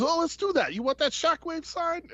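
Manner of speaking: high Barbarino-style wise-guy voice; artist's voice. Retold or imitated. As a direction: ask it like a Brooklyn tough guy. Voicing the artist.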